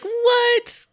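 a squeaky, very high, disbelieving yelp; incredulous what. Retold or imitated. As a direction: shoot up into a shocked squeal. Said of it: "Keegan-Michael Key is just like".

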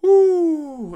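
usual speaking voice

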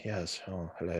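soft, lightly voiced posh British Jedi; a hushed hint of refined British hello; Obi-Wan Kenobi. Fan imitating a female take on the Jedi, posh British greeting. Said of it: "Obi-Wan Kenobi, but a female sort of".

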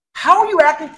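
high squeaky tongue voice; high, laughing voice for his tongue; voicing his tongue. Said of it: "My tongue is like".

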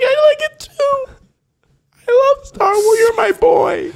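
high, choked-up proud father voice; almost crying with joy; teary proud dad. Pretend. A father tells his newfound son he loves Star Wars, teary and high.

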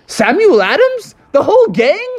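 high, astonished questions; can't believe his eyes; amazed. Acted. Facility owner stunned to meet the founding fathers: high amazement.

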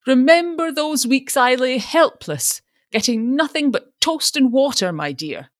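put-on milk his old illness for sympathy; self-pitying father. A narrator's reading. Father dramatizing past illness.

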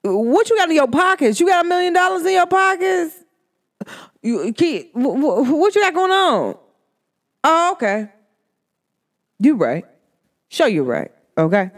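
high, scornful questioning; mocking challenge. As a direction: sneer at what he has to offer. Mocking the dude's worth.

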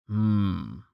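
soft rumbled hum; lightly voiced rumbling giant; low rumble. A narrator's reading. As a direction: rumble a quiet, thoughtful hmm. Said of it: "Tallheart rumbled".

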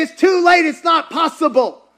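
high, loud protest; impassioned objection. Acted. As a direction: cry out the doubter's protest. Voices the congregation's despairing objection.

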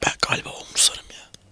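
whispered, low and dropped; sad hushed voice. Real, not acted. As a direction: let the voice sink in sadness. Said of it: "böyle üzülünce sesimi düşürdüm".

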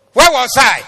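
high, loud rhetorical cry; shouted preacher's question; loud call. Real, not acted. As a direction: fling the question out to the church.

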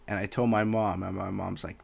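usual speaking voice

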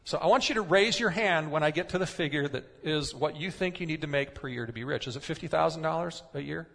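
normal talking voice

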